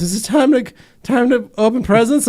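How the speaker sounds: put-on high eager child voice; hopeful kid. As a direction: ask like a kid hoping for presents. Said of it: "he goes".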